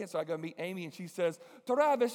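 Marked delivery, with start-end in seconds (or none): 1.7-2.1 put-on high, loud, insistent young woman; high eager girl. The Chinese girl confronting the team, high and insistent. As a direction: press them, high and pushy. Voices Amy.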